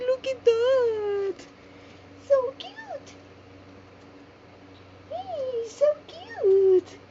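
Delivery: high squeaky cooing; excited squeal. Real, not acted. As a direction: squeal over something adorable. Gushing over the doll.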